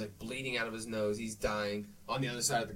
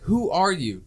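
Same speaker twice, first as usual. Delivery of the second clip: loud, higher shouted question; retold shout; 7 semitones higher, 10 dB louder, slower than usual. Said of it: "He's like".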